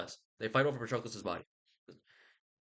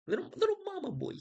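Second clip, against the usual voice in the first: put-on "Little, little mama boy" in a much higher squeaky baby voice; mocking baby voice; faster than usual. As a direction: tease him like a whiny toddler. Mocking Achilles as mama's boy.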